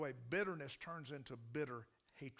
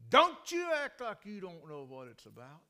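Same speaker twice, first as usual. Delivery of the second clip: loud, angry scolding; she's furious and lets him have it; mad; 2 semitones higher, 13 dB louder than usual. Retold or imitated. Lady on the phone blasts the pastor, loud and angry. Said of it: "she flew mad".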